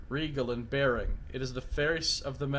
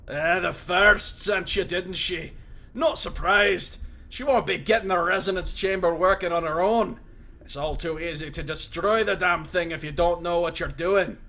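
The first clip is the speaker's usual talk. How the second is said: loud, raised voice of a wounded prisoner; gruff Mazov; 4 semitones higher, 6 dB louder than usual. Acted. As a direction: bark it at the intruder in surprise. Mazov voice, knowing.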